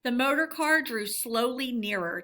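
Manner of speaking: ordinary delivery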